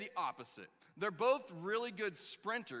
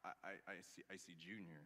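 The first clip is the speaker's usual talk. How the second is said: very low, soft, faltering stammer; weak, mild response; 13 semitones lower, 14 dB quieter, faster than usual. Retold or imitated. Junior answers Yul at the mirror, meek and hesitant. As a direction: shrink into a timid stammer. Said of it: "this really mild, kinda weak response".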